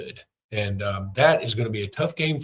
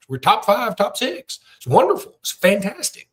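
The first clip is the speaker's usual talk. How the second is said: much higher and louder, gushing; excited hype; 9 semitones higher, 4 dB louder than usual. Real, not acted. Host gushing to fans about Alabama's value, excited and high. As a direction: rave about the program.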